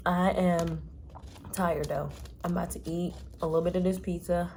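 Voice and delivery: tired hushed chat in hotel room; hushed late-night talk. Real, not acted. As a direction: talk quietly so neighbors won't hear.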